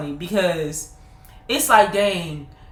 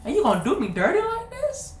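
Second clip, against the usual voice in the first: put-on high, laughing child's protest; high laughing cry of betrayal; laughing mock outrage; 8 semitones higher than usual. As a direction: play your younger self, betrayed and amused. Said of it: "I was like".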